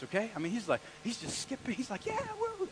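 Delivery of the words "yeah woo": high, small whoop of joy; giddy high-pitched celebrating; happy. Acted. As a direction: skip along with a light cheer. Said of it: "he's doing, like, the happy dance".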